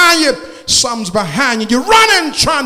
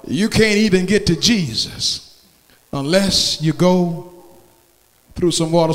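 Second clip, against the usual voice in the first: usual speaking voice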